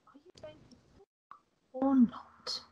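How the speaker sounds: impatient whisper. Acted.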